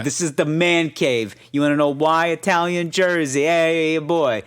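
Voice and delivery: lightly voiced proud Jersey dad; Jersey guy. Mocking Jersey guy showing off his man cave. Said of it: "he's definitely a Jersey guy".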